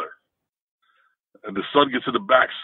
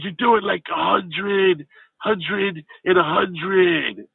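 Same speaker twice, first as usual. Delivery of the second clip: higher, slow, laid-back California surfer voice; 7 semitones higher, much slower than usual. Retold or imitated. Big stoned son in the back seat greeting the driver, higher California surfer voice. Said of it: "California-ish surfer guy".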